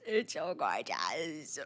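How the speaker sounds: low slow mock voice. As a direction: drop into a low, slow murmur. Repeats line in a low voice.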